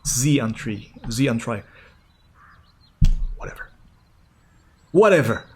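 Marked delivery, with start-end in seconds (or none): 3.4-3.7 muttered aside. Real, not acted. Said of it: "One of these variations was almost right". 4.9-5.4 dismissive. Real, not acted. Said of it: "One of these variations was almost right".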